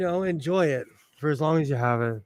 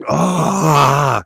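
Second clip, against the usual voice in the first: tearful cry; 7 dB louder than usual. Real, not acted. Tearful outburst while crying.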